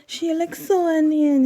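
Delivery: high, lilting imitation of an Indian wife's accent. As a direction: mimic the wife gushing in an Indian accent. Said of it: "in their accent".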